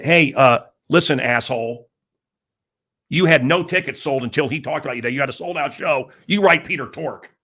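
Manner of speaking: loud, forceful telling-off; retold angry scolding. [name] telling [name] how to sign, scolding.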